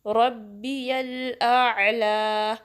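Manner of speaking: रब्बियल आला with the final आला stretched for two counts, very slow; careful tajweed stretch. Shown as a demonstration. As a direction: draw out the आला at the end. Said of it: "टू count stretch करना है".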